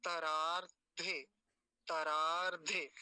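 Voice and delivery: "तरार्धे" drawn out, long middle आ, separate धे; careful syllable demo. Shown as a demonstration. Teacher joining the two parts for students, slowly sounded out. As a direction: say "तरार्धे" slowly with a held "रार". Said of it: "इन दोनों को मिलाते हैं".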